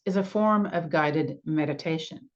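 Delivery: normal talking voice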